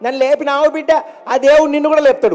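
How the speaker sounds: put-on high old granny's blessing voice; old granny blesses the comedian who helped her up; old woman. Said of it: "ఆ అవ్వ నన్ను అన్న మాట".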